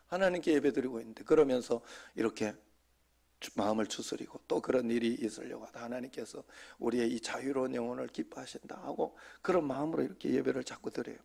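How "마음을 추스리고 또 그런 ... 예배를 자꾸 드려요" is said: usual speaking voice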